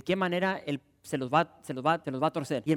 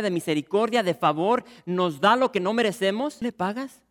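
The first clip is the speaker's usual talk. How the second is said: loud, rising pulpit climax; fervent preaching; 4 semitones higher, 5 dB louder than usual. Acted. Pastor urging believers to answer God's love, loud building preaching. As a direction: build the sermon to a loud peak.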